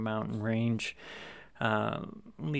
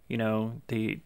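ordinary talk, nothing marked

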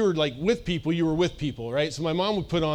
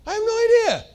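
very high, incredulous squeak; playful, exaggerated protest; mock cluelessness; 5 dB louder than usual. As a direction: shoot the voice up high as if baffled. Acts out exasperated answer to askers.

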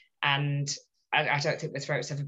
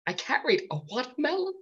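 higher, awkward rising film quote; Baby impression; 7 semitones higher than usual. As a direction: say it high and sheepish. Quoting Dirty Dancing.

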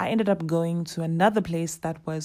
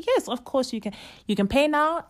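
lightly voiced receptionist, higher; cheerful receptionist; 7 semitones higher, faster than usual. Receptionist confirming the exchange, higher and cheerful. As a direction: brightly reassure the customer.

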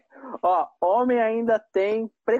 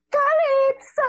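high, loud proclaiming; shouted name; 4 dB louder, much slower than usual. Pretend. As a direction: announce the name with flourish. Bard proclaims her name.

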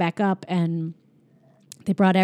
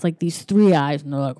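ordinary talk, nothing marked

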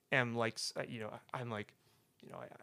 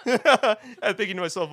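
laughing while telling; 6 semitones higher, 6 dB louder than usual. Real, not acted. Laughing at himself.

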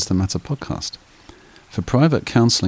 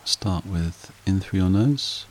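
slow, calm meditation guide voice; guided meditation; 6 dB quieter, slower than usual. Acted. Meditation host guiding listeners to relax, calm and unhurried. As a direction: ease the listener down into stillness.

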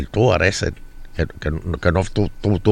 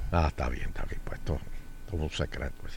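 hushed; 6 semitones lower, 16 dB quieter, faster than usual. Real, not acted. Secret told off the air.

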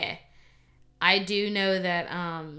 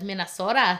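Minnesotan accent, exaggerated and louder; mock Minnesotan accent; 5 dB louder than usual. Shown as a demonstration. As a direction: make fun of a Minnesotan accent. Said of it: "making fun of, like, the accent".

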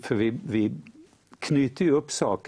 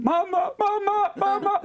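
hammed-up terrified call in a skid; retold panicked scream. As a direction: cry for mum as the car skids. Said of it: "så skrek hon".

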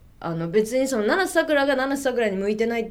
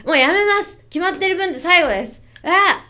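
higher, louder blurt; blurted announcement; 7 semitones higher, 5 dB louder than usual. Retold or imitated. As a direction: blurt it out on impulse. Retold impulsive announcement.

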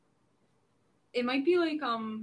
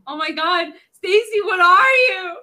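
high, loud shocked cry; shocked shout; 7 semitones higher, 16 dB louder than usual. Pretend. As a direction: yell it out in disbelief. Player cries out at Stacy.

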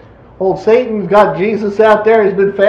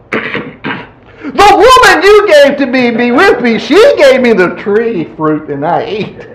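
very sad. Acted. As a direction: whimper the excuse slowly, letting it sink. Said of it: "in a very sad kind of way".

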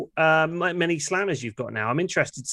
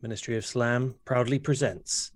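grave announcer voice; 2 semitones lower, 5 dB quieter than usual. Acted. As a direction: announce it with great gravity. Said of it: "a very serious wrestling promo".

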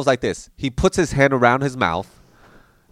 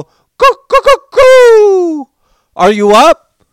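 high falsetto call, much louder, like yelling for help from a mountain top; mountain call wake-up; 12 dB louder, much slower than usual. Retold or imitated. Dad cupping his hands to wake his son: high, loud hooting call. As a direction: hands round the mouth, hoot it out high and loud. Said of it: "look at me in the eye and go".

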